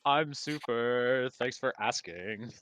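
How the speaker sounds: loud, slow sarcastic I'm super; mock-cheerful loud reply.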